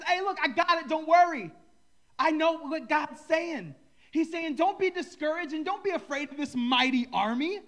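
loud, high, know-it-all reassurance; the man with the plan, hammed up; confident messenger. Bob tells the king he has God's word, hammed-up confidence. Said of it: "he says".

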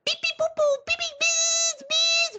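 very high, loud detector beeping; piercingly high mimicked alarm beeps; imitated electronic beeps. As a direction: squeal beeps and boops like a gadget. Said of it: "all I hear is".

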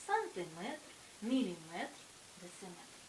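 сантиметр, міліметр, дециметр stressed on last syllable МЕТР. Shown as a demonstration. Teacher listing length units, each stressed on final МЕТР. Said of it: "наголос падає на метр".

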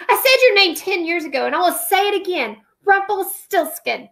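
defiant queen. Acted. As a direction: fling the name at him. Queen defies the little man.

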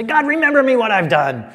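high, loud pleading cry to God; pleading Nehemiah. Acted. Nehemiah pleading with God over his work, loud and high.

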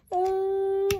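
odd high noise. Real, not acted. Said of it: "I don't know why I'm doing this".

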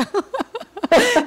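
sudden loud laugh that breaks out mid-story; loud burst of laughter. Real, not acted. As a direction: laugh out loud, high and bright. Risada alta no meio do relato. (risada alta e repentina)

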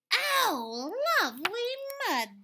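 high loud delighted cry; gleeful chorus of animals; mud-loving glee; happy animals. A narrator's reading. Animals together again.

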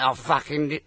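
normal talking voice

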